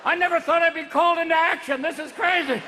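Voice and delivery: high, loud comic panic; bewildered old sperm. Acted. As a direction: holler like a lost old recruit. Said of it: "two fifty-year-old sperms going".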